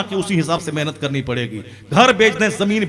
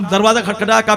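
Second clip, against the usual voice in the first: ordinary delivery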